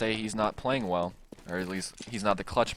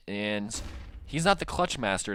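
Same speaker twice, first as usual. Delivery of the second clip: ordinary talk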